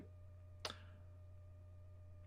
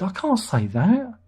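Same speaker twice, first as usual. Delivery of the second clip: [name] quote; 6 semitones higher than usual. Retold or imitated. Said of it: "even [name] said".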